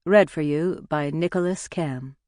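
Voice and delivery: usual speaking voice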